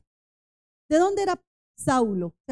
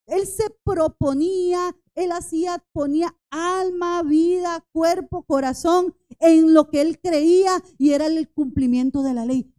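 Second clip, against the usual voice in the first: voz de predicador que sube con fuerza, lenta al inicio; predicación ardiente; 3 semitones higher, 6 dB louder than usual. Acted. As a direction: predicar con pasión, cada vez más fuerte. Preacher builds intensity. (predicando con fervor, cada vez más fuerte)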